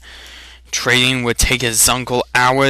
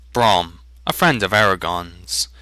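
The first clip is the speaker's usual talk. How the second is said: usual speaking voice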